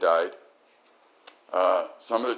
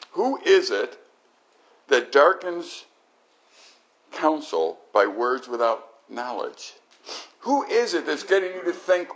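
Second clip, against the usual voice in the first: God's question; 6 semitones higher, slower than usual. Retold or imitated. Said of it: "he asks him this question".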